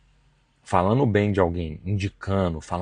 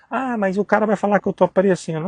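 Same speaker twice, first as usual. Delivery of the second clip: higher whiny worry; whiny objection; 10 semitones higher, 3 dB louder than usual. Retold or imitated. Preacher imitating a believer afraid of looking showy, higher whine. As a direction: whine the excuse like a timid believer.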